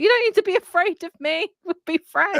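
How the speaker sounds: put-on a kid kindly reassuring a ghost; little boy. Little boy voiced talking to ghost.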